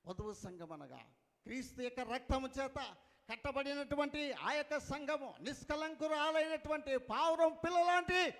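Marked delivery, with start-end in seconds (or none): 1.4-8.3 rising, loud sermon declaration; preaching. Real, not acted. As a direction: build it up as a preacher's proclamation. Preaches at full force.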